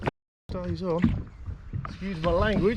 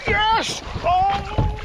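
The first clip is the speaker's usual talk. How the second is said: very high, loud yell; triumphant shout; 5 dB louder than usual. Real, not acted. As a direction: cheer at the top of your voice. Lands the monster pike.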